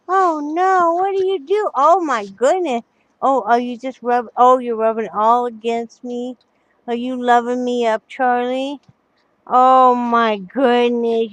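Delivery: high loud doting coo; talking to a dog. Real, not acted. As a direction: fuss over the dog lovingly. Cooing at dog.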